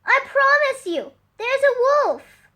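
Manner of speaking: high, loud pleading; begging. Acted. Sam pleading with doubting villagers, high loud begging. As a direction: beg them to believe you. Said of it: "he begged".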